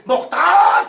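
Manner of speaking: loud, high call into the prison. Acted. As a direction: summon the prisoner across the cell. Soldier calls into the dark cell. (زور دار پکار)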